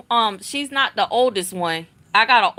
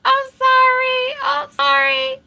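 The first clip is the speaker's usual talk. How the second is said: very high, louder sheepish sorry; squeaky apology; 13 semitones higher, 4 dB louder than usual. Retold or imitated. Daughter apologizing to her listening father, high squeaky sorry. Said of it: "She was like".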